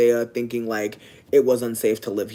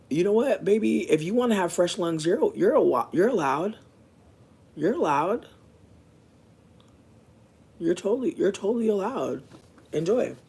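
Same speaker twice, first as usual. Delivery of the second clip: higher, sugary mock permission; sarcastic sweetness; 5 semitones higher than usual. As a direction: grant it with fake sweetness, a bit higher.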